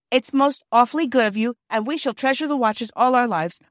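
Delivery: put-on a shy boy hurrying through his speech; nervous rush. A narrator's reading. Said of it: "he went on with a rush".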